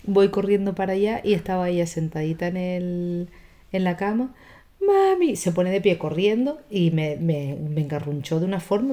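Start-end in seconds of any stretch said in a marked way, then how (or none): 4.8-5.3 put-on high, loud child's cry of mami. Daughter wakes from a nightmare, crying out high. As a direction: call out like a scared little girl. Frightened daughter calls from bed.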